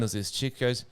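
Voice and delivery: ordinary delivery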